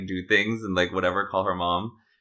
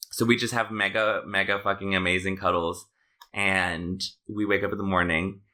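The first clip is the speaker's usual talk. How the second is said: ordinary delivery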